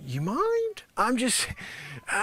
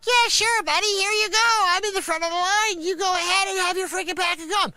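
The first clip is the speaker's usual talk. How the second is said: high, loud, breezy fake kindness; mock friendly shopper; 9 semitones higher, 3 dB louder than usual. Acting out what the shopper should say, high and loud. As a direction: play the generous stranger, high and loud. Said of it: "You should be like".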